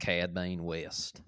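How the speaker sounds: put-on slow thick Southern accent drawl. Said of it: "say it with a thick Southern accent".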